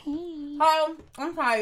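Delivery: ordinary talk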